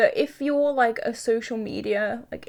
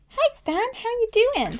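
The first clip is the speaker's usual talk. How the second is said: much higher, slow cooing at the dog; pet voice; 12 semitones higher than usual. Real, not acted. Greeting her dog.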